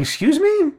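high, rising outraged disbelief; incredulous excuse me. Retold or imitated. Retelling the manager's offer, a high incredulous squeak. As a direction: soar up in shocked disbelief. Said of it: "I'm like".

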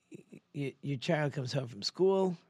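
ordinary talk

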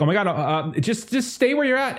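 flustered desk clerk. Acted. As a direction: nervously tell the caller to stay put. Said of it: "there's people on the phone like".

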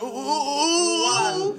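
slow, high whimpering ooh; whimpering ooh sounds. Retold or imitated. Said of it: "I'm talking about stuttering".

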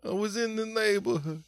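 high, far-off put-on voice of the visitors; mimicked family at door. Comedian mimicking relatives at the door, high put-on voice. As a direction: squeak it out like surprised relatives.

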